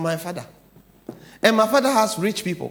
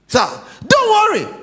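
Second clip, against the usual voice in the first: much higher, loud cry of comfort; shouted reassurance; 12 semitones higher, 6 dB louder than usual. Acted. Preacher shouting comfort to a fretting listener, high and loud. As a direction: call it out to calm the worrier.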